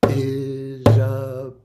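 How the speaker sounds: very slow, loud Isabel; slow syllables. Shown as a demonstration. Teacher saying name over drum beats, slow. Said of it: "while I say Isabel's name".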